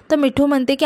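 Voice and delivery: ordinary delivery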